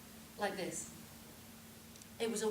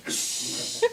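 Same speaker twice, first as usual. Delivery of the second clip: loud voiceless hiss; imitated cat hiss; 6 dB louder than usual. Storyteller imitating the cat that cornered a fox, hissing. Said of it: "The cat was going".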